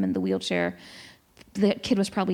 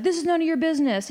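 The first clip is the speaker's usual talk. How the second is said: higher, fast imitation of a hostile man; mock angry retort; 8 semitones higher, faster than usual. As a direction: fling it back fast like the rude stranger.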